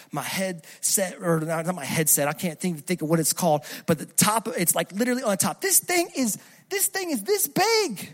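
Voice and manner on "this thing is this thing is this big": high-pitched, drawn-out amazement; amazed exclamation. Retold or imitated. Preacher shows how big the spider was, high amazed voice.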